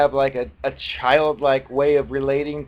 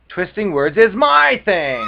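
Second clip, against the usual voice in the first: mock gloating boast; 6 semitones higher, 9 dB louder than usual. Gloating translation of creature's words.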